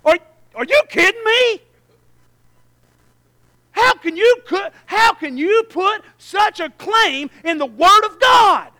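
loud, high disbelieving cry; slowing to a stunned shout at the end; astonished. Retold or imitated. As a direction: shout your amazement at the claim. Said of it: "I was sitting there going".

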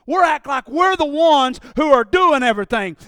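ordinary delivery

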